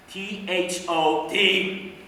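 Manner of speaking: slow, letter by letter; drawn-out letters, each set apart; spelling out. Acted. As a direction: spell it out one deliberate letter at a time. Spells out brother's misspelling.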